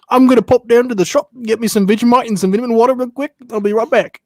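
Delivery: chirpy Cockney shop run; Cockney accent. Retold or imitated. Friend showing off a Cockney accent to the podcast crew. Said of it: "swap to my Cockney accent".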